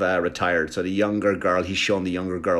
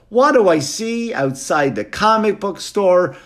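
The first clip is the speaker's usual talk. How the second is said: dramatic question; 10 semitones higher, 5 dB louder, slower than usual. Acted.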